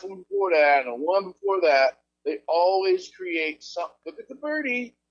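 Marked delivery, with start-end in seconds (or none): 4.1-5.0 high, soft lure; mock distraction. Officials distracting the public from a crisis, mock-playful and high. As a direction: point away in a high, hushed singsong.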